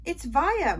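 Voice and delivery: plain reading voice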